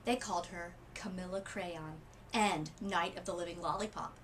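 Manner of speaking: ordinary delivery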